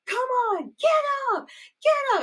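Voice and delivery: high, loud mock yell at a downed killer; hammed-up high shout; retold yell. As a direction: yell it like the final girl urging him up. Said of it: "she starts yelling".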